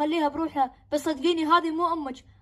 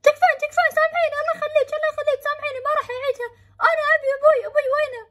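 put-on pleading kid; 10 semitones higher, faster than usual. Frightened son begging his possessed mother, high and panicked. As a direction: beg her desperately not to hurt you. Boy begs mother for forgiveness.

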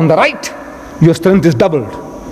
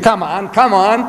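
higher, drawn-out goading; impatient demand; 4 semitones higher than usual. Retold or imitated. Reverend banging his feet at the Maulana, demanding. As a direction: push slowly and insistently for an answer. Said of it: "again started ba-, banging his feet".

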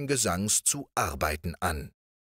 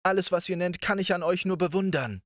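lightly voiced princess, higher; princess exclaims; 11 semitones higher, faster than usual. A narrator's reading. The princess exclaims to Riquet, higher. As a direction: admire him openly. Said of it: "rief die Prinzessin".

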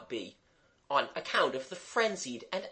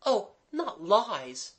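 put-on high, slow girl's protest; 8 semitones higher, slower than usual. A narrator's reading. Mabel defending Gerald to Jimmy, protesting. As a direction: draw out the objection in a high voice. Girl objects in character voice.

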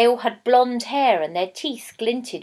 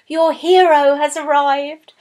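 higher, louder excited call; eager women calling to the princess; excited announcement; 5 semitones higher, 7 dB louder than usual. A narrator's reading. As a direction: announce the hero breathlessly. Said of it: "They said".

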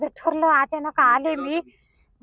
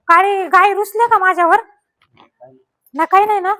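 coaxing the cow; 5 semitones higher, 5 dB louder than usual. Real, not acted. Woman at the cowshed coaxing a sulking cow to eat jaggery, higher sing-song. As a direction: sweet-talk a sulky cow into eating.

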